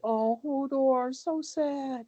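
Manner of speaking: high, slow, hammed-up fake pity; sing-song mock grief for Hodor; mock sorrow. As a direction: drag it out like an overly sentimental fan. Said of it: "everyone's like".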